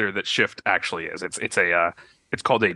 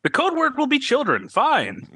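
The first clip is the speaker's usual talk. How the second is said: very high, swooping creature voice; changing shapeshifter voice. Acted. Shapeshifter settling on a code word, high wobbly voice. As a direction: let the pitch leap right up. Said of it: "his voice is, like, changing".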